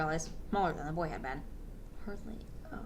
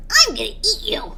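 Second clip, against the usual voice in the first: higher, louder creature line; bug monster voice; 9 semitones higher, 5 dB louder than usual. Shown as a demonstration. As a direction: squeak it out as a little bug monster. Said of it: "I'm going to say it as Glub".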